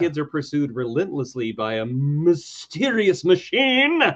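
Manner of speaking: hammed-up next-week promo read; dramatic episode preview. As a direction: build to a swooping high reveal, then hush.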